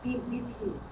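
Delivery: soft, slow, calm greeting; Jesus calms the frightened room, softly; gentle blessing. Acted. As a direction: speak peace gently and slowly. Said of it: "he says".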